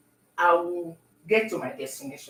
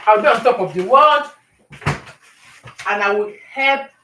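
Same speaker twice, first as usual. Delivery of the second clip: much louder, overjoyed; joyful shout; 9 dB louder than usual. Real, not acted. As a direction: shout your dream out happily.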